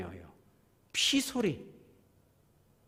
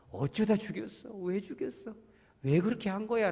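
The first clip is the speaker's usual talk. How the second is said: plaintive questioning, pitch raised; earnest pleading; 6 semitones higher than usual. Retold or imitated. God earnestly asks Cain why, pleading. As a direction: plead with a wayward son, higher. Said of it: "정말 간곡하게 묻고 있는 거예요".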